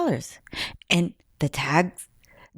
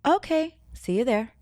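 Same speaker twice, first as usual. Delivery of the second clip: casual okay; 7 semitones higher than usual. Retold or imitated. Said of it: "But instead they said".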